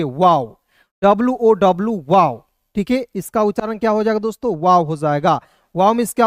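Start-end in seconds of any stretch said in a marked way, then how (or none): 0.0-0.6 वाओ with an "au" glide, like English wow; pronouncing wow. Shown as a demonstration. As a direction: say वाओ low, gliding from a to u. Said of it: "इसका उच्चारण औ के रूप में करेंगे".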